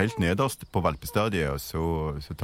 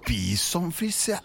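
high, slow questioning repeat; astonished echo; 8 semitones higher, slower than usual. Acted. Boy hearing the dog is a bison frisé, astonished. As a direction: draw out the breed name in wonder. Amazed echo of the breed name.

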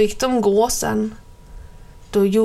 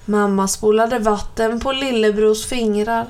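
ordinary delivery

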